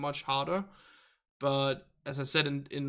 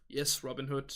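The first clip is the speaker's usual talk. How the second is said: ordinary talk, nothing marked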